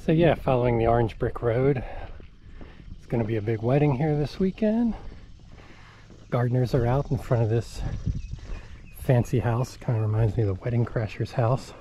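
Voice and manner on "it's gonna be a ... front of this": ordinary talk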